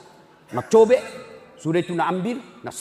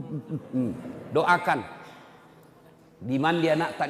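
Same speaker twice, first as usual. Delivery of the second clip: normal talking voice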